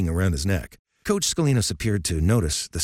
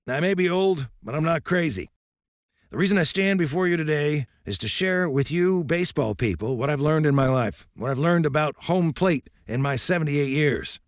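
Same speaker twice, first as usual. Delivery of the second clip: put-on lightly raised, gruff old coach lecturing; old coach's voice; 8 semitones higher than usual. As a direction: speak as a stern old baseball coach.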